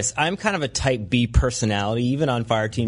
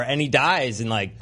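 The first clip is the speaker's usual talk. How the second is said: ordinary talk, nothing marked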